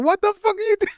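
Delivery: very high imitated plea; panicked mock squeal. Voicing Timmy backed in the corner, panicked mock squeal. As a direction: squeal it like cornered prey, playing it up. Acting out Timmy fending off cameraman.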